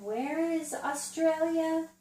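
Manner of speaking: slow, high question to a little one; quizzing a child. Shown as a demonstration. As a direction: stretch it out high, prompting a child to answer. Models quizzing a young child.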